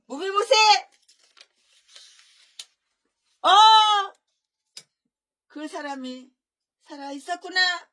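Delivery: high, loud cries of glad surprise; surprised and glad. Acted. David greets Mephibosheth in glad surprise, high and loud. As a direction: call the name out in joyful disbelief. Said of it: "놀랍고 반가운 표정으로 이렇게 말을 했어요".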